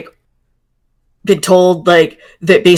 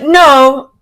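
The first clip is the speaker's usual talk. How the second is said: higher-pitched, firm "no"; sudden denial; 6 semitones higher, 4 dB louder than usual. Acted. Player voicing her character's turn from embarrassment, sudden denial. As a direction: push back against the embarrassed thought. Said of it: "But then there's, like".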